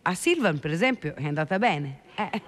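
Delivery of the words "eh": chuckle. Real, not acted. Laughs at own joke.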